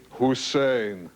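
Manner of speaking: put-on dictator introducing himself; Saddam Hussein. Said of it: "he'd say".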